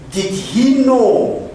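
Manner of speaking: ordinary delivery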